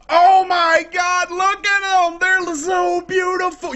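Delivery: high, drawn-out gushing; hammed-up swooning over something beautiful; mock freak-out. Gamer gushing over new stairs, hammed-up freak-out. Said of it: "You know you're freaking out".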